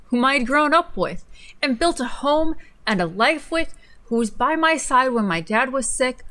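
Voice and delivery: dramatic reading. Acted. Friend reads fanfic aloud as a dramatic reading. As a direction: ham up the heartbreak. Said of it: "in a dramatic reading".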